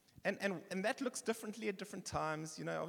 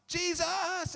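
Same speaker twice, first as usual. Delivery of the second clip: high shouted call of the name; desperate roadside shout; 13 semitones higher, 8 dB louder than usual. Retold or imitated. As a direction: call out Jesus at the top of your voice. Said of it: "but he shouts".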